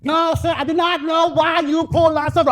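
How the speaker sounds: very high-pitched, put-on mother voice; mom's over-enunciating voice. Mom answering the cop, high over-enunciated voice. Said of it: "Here went my mother".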